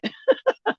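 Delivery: quick high laugh; real laugh. Real, not acted. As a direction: let a short high laugh out. Laughs after cursing the deductible.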